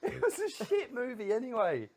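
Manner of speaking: laughing. Real, not acted. Laughing over the bit.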